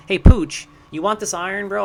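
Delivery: loud call to a friend. Real, not acted. Streamer calling out to his friend Pooch, loud and high. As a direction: get Pooch's attention.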